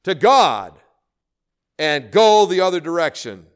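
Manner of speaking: loud preached plea. Acted. Preacher voicing God's call, loud and urgent. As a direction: throw the plea out to the room. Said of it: "He says".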